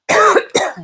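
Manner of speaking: sudden dry cough; coughing on dust. Real, not acted. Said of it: "sorry, all of a sudden just get a dust in my throat".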